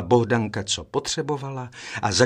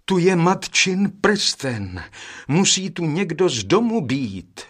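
joyful cry; 6 semitones higher, 4 dB louder than usual. A narrator's reading. Eldest brother finding mother's ring, joyful cry. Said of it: "vzkřikl radostně nejstarší".

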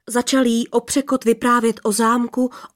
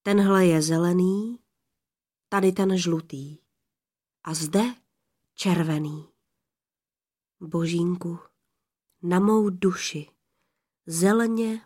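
ordinary delivery

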